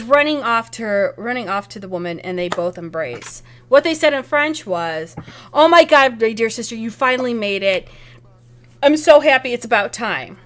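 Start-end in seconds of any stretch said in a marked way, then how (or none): 5.5-10.4 loud, delighted reunion outburst; joyful greeting. Acted. Ruby to her newly arrived sister, joyful greeting. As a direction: greet a long-lost sister with loud joy.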